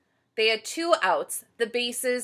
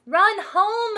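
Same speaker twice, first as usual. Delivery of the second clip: high loud urging; shouting boys; 13 semitones higher, 8 dB louder than usual. Acted. Boys urging Amelia Bedelia home, loud shout. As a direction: call her home, urgently. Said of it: "shouted the boys".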